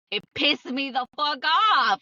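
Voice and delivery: high, loud, drawn-out venting; exasperated. Real, not acted. Venting about past anger.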